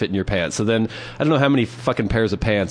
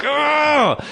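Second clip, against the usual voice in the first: high, louder grunt of mock effort; straining grunt; 4 dB louder than usual. Imitating a girlfriend squeezing, high strained grunt. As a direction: grunt like you're squeezing with everything.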